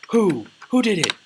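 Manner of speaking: high, very loud urgent questioning; acted loud demand. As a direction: demand the culprit's name, hammed-up. Angry leader demands the culprit.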